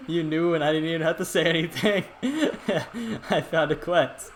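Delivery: talking through helpless laughter; words broken up by laughing; exasperated laughing. Real, not acted. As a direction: laugh between phrases, half beaten. Laughs in disbelief after the outburst.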